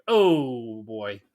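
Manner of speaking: a put-on dry "oh boy"; mock dismay. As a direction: voice your own groan at a bad accent. Quoting own reaction to accent.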